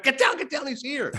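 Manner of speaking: high, urgent mock warning; mock surprise-party alert. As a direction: excitedly warn everyone, pitch jumping up. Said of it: "it felt like a surprise party".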